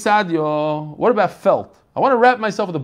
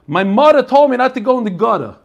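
loud, higher Brooklyn voice dropping every R; broad R-less Brooklyn talk; Brooklyn accent; 4 semitones higher, 6 dB louder than usual. Shown as a demonstration. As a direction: say it like a Brooklyn local, "mutha", "gutta". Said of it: "That's how they say it".

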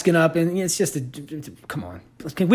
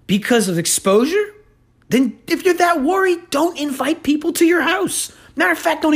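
incredulous rant; 11 semitones higher than usual. Real, not acted. Incredulous rant about neighbor.

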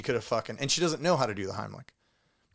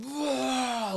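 high forced retch, pushing hard; retold retching; 12 semitones higher, 3 dB quieter than usual. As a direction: mimic trying to puke. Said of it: "trying to puke as hard as I could".

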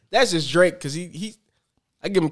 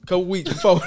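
ordinary talk

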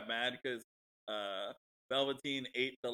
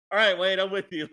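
loud, high, mock shout; 6 semitones higher, 11 dB louder than usual. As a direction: call it out as if cheering him on. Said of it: "I was like".

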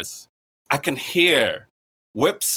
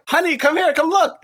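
excited call; 13 semitones higher, 5 dB louder than usual. Retold or imitated. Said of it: "she's like".

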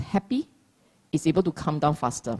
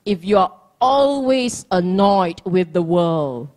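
ordinary talk, nothing marked

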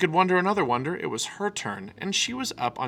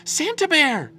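excited squeal of a name; a little cub crying out with delight; high, loud excited cry; cried out; 7 dB louder, slower than usual. A narrator's reading. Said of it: "she cried".

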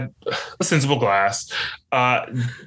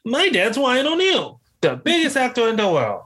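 put-on child's voice; 13 semitones higher than usual. Reciting his audition monologue, in a high childish brag. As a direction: boast like a proud little child. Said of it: "that's how children talk".

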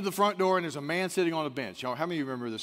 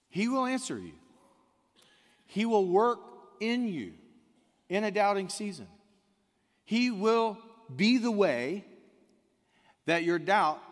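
rising preaching; 5 semitones higher than usual. Real, not acted. Preaching at full force.

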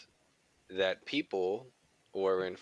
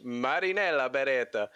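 name rolled in an Italian accent; Italian-accented name reading; 3 semitones higher than usual. Shown as a demonstration. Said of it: "what'd you have to say with that accent".

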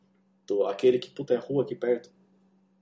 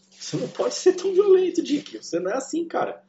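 pleading outburst; 4 dB louder than usual. Retold or imitated. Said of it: "eu falei, mano".

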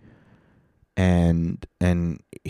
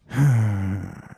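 long audible sigh; 2 semitones higher than usual. Real, not acted. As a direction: let out a long breath. Pause before next news item.

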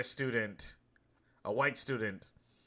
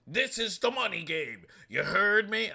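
higher, louder Cleveland-styled boom; Steve Harvey impression; 9 semitones higher, 5 dB louder than usual. Still imitating the motivational speaker for viewers, hammed-up, higher and louder. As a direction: push the catchphrase like a hammed-up hype man. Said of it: "my best Cleveland accent".

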